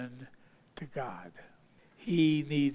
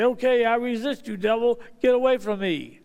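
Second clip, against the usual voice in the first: loud, raised-pitch rebuke; mock defiance; 5 semitones higher, 6 dB louder than usual. Believer telling the devil to get away, mock defiance. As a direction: order the devil off, louder and higher.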